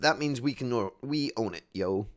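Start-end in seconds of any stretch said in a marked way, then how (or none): none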